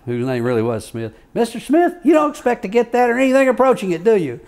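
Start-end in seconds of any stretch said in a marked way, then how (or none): 1.3-4.4 high, loud, fast judge voice; mock shouting judge. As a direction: shout Mister Smith, then rush the question. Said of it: "He says".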